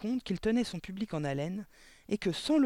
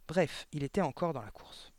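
usual speaking voice

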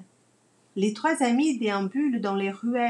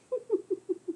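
sly chuckle; 9 semitones higher, 4 dB quieter than usual. Acted. Boasting grandpa chuckles.

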